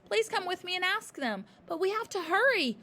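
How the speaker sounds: high loud hurried plea; urgent child. A narrator's reading. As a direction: hurry the teacher. Matilda pulling teacher along.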